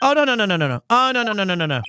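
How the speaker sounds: high, rattled-off string of denials; flustered denial. Acted. Game character reassuring a disappointed actor, flustered. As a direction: tumble out the no's, starting high.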